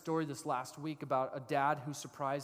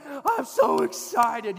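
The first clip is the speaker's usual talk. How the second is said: high, loud, drawn-out squeal of excitement; totally stoked kid; 12 semitones higher, 15 dB louder, much slower than usual. Acted. Youngest reacts to bounce house trip, totally stoked. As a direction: play a youngest child bursting with excitement. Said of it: "totally stoked out of his mind".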